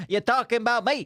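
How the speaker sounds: high, cocky New York American; thick American accent. Acted. Comedian answering who the cute American was, thick American accent. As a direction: brag in a broad American accent. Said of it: "we just did our thick best American accents".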